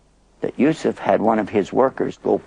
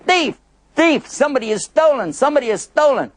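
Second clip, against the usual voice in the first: hollering alarm; 11 semitones higher than usual. Acted. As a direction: raise the alarm high. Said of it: "somebody hollered".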